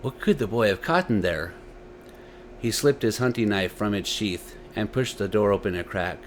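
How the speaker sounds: usual speaking voice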